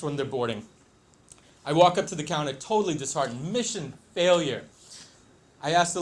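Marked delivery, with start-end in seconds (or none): none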